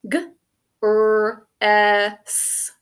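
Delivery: "g, r, s" as isolated phonemes, drawn out; phonics-teacher "g, r, s" with gaps between; single sounds. Shown as a demonstration. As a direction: sound out "g, r, s" one by one. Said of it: "Next syllable".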